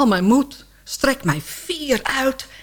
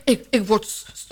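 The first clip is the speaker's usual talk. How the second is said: ordinary talk, nothing marked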